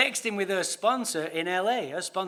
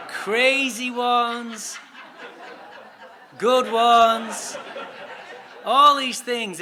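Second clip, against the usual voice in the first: laughing; 3 semitones higher, 9 dB louder, much slower than usual. Real, not acted. Laughing about soap operas.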